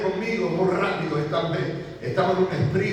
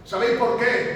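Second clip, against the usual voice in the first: ordinary talk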